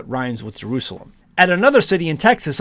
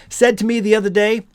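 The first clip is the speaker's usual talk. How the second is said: acted loud, higher-pitched question; pointedly audible, pitched up; rather loudly; 6 semitones higher than usual. A narrator's reading. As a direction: raise the voice for the quoted speaker. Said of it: "rather loudly".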